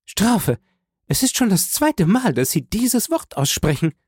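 high, alarmed woman's voice; Frau von Villefort. A narrator's reading. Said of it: "rief Frau von Villefort".

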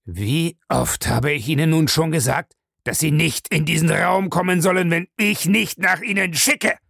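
exasperated rebuke rising in pitch; angry scolding. Acted. Master scolds butler for entering uncalled, angrily. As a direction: scold him, building to the end.

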